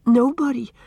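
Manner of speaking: scared little answer; timid reply. A narrator's reading. Frightened girl answers the bully.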